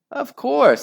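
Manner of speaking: high mock-sweet "of course"; sweet fake agreement, way up high; high sarcastic Henry. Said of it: "said Horrid Henry".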